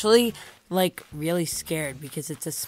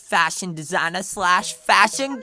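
loud, show-off pop star wannabe; peppy villager Bubbles; 5 semitones higher, 10 dB louder than usual. Acted. Bubbles hands over her recipes, loud and peppy. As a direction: build to high, loud excitement.

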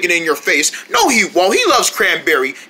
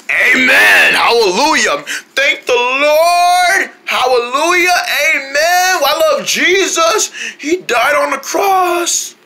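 fervent preaching; 5 semitones higher, slower than usual. Acted. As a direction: testify like in church. Fervent response to grace.

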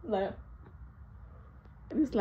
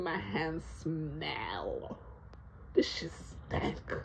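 hushed disgust; 4 semitones lower, 10 dB quieter, much slower than usual. Retold or imitated. Noticing smell, whispered.

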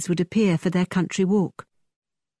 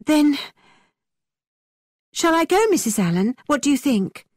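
put-on a higher, earnest young girl, polite; higher young lady voice; 7 semitones higher than usual. A narrator's reading. Catherine politely refusing Thorpe's invitation, earnest young voice.

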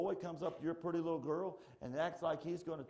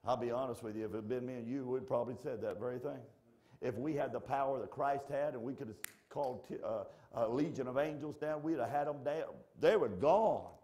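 ordinary talk, nothing marked

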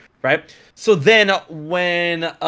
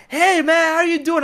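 cheery greeting; 13 semitones higher than usual. Retold or imitated. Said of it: "Jacksepticeye is like".

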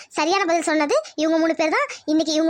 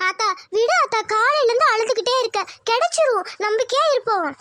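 put-on higher young girl voice, gentle; consoling daughter; 6 semitones higher than usual. Daughter comforting her weeping mother, gently. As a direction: soothe your crying mother.

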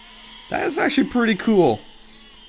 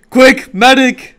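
slow loud call for a medic; man down shout; 6 semitones higher, 7 dB louder, slower than usual. Pretend. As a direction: shout for help like a soldier. Mammoth broke its leg.

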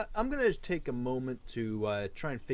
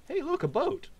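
surprised; 3 dB louder than usual. Real, not acted. Surprised discovery.

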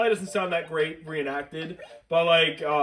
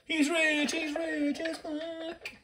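squeaky villager voice; 12 semitones higher, 4 dB quieter, much slower than usual. Pretend. Mimicking villager lines.

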